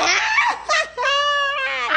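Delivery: long, high burst of laughter; shrieking laughter. Real, not acted. Friends erupt at the dare. (matinis na halakhak)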